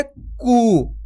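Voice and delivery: long held कू; drawn-out long oo. Shown as a demonstration. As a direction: stretch the vowel of कू out long. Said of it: "खींच के पढ़ेंगे". (कू को खींच के लंबा पढ़ें)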